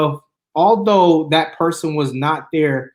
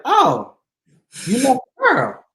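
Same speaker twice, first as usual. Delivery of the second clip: much higher, surprised mom voice; mom's voice; 9 semitones higher, slower than usual. Retold or imitated. Mother hearing her son left the girl, high surprised voice. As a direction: play his mom, taken aback. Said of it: "She's like".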